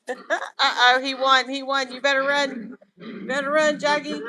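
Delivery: high, loud, gleeful cheering; egging on the winner; excited cheering. Real, not acted. Owner cheering on winning goat, high and loud.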